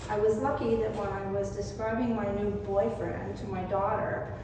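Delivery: ordinary delivery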